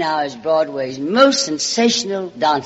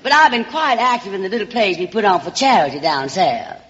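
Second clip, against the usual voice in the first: Southern belle voice; 4 semitones higher than usual. Acted. Alabama girl sweet-talks the producer's secretary, in a Southern belle voice. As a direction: play the small-town Alabama charmer calling everyone sugar and darling.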